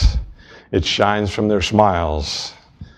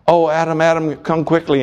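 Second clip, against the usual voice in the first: mild mock yell; 6 semitones higher than usual. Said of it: "She runs and yells".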